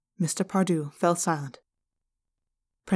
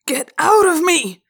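slow, high, anguished scream; sudden scream; 10 semitones higher, 7 dB louder, slower than usual. A narrator's reading. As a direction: scream it out in torment. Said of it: "Mister Pardue screamed suddenly".

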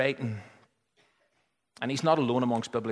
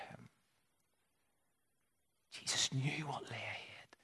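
hushed whisper; 10 dB quieter than usual. Real, not acted. Preacher whispering to the congregation about Jesus, hushed.

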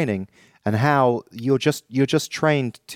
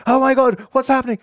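hammed-up panic, higher and louder; mock-panicked cry, higher and louder; 13 semitones higher, 4 dB louder than usual. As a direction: play a frightened astronaut, over the top. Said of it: "when the shit's hitting the fan".

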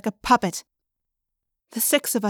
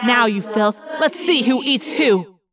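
angry threat; 4 dB louder than usual. Acted. Bear attacks hated villain.

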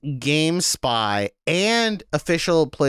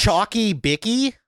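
Chalky bicky, higher, Aussie accent; Australian slang; 7 semitones higher than usual. Shown as a demonstration. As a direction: say it like an Aussie kid. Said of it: "chocolate biscuit".

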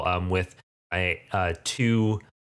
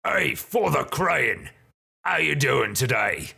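hobgoblin leader; 6 semitones higher than usual. Acted. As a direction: greet the priest in a rough-edged character voice. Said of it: "the one in the middle says".

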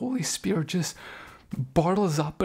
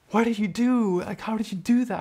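imitated amazement, higher-pitched; astonished; 5 semitones higher than usual. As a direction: act out his stunned disbelief. Healed man reacts in astonishment.

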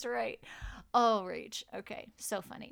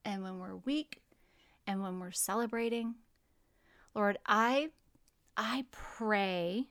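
prayer; 3 semitones lower, 4 dB quieter than usual. Acted. Prayer continues.

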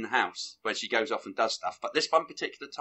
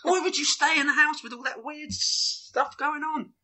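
much higher, disbelieving outburst; incredulous; 13 semitones higher, 4 dB louder than usual. Real, not acted. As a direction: squeak with disbelief at her choices. Incredulous rant about the heroine.

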